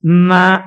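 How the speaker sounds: నా said loud, pressed with the tongue; tongue syllable. Shown as a demonstration. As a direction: punch నా out with the tongue tip. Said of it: "నకారంతో మొదలవుతుంది".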